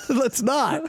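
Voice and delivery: high laughing brush-off; laughing refusal. Real, not acted. Answering the prayer joke, laughing refusal. Joke reply to "let's pray.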